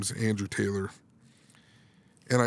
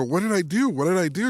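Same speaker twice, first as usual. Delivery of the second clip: high, louder puzzled question; mock-alarmed, pitched up and insistent; retold bewildered protest; 7 semitones higher, 5 dB louder than usual. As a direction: act out being baffled and wrongly accused.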